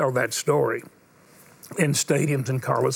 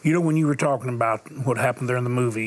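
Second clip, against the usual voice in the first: ordinary talk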